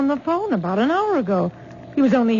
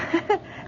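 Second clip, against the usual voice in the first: soft breathy laugh; 8 semitones higher, 6 dB quieter than usual. Acted. Mother amused by the question.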